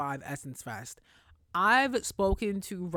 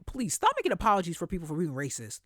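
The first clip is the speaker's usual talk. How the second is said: usual speaking voice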